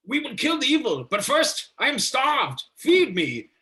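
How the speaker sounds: high, hammed-up proclamation; Van Helsing. Vampire hunter demanding dinner from his companion, hammed-up high declamation. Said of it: "Van Helsing is like".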